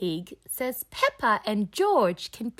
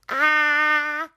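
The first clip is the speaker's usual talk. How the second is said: say ah; 7 semitones higher, 6 dB louder than usual. Acted. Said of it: "says George".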